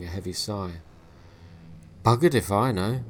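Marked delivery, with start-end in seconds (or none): 2.0-3.0 loud, blunt, weary retort; the mortician shrugging off the boy, loud and blunt; gruff shrug. Acted. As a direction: toss it off like an old grump. Gruff mortician after sigh.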